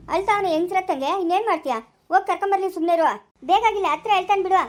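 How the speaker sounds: heated scolding. Acted. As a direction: scold them hotly over the family trouble. Heated family argument.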